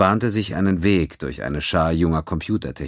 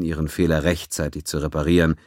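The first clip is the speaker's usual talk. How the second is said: ordinary talk, nothing marked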